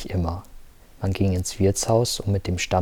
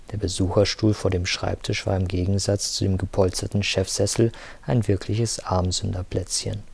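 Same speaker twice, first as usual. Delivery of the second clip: plain reading voice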